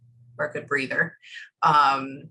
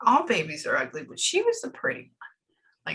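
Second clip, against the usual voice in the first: imitating the woman; 3 semitones higher than usual. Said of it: "Like that".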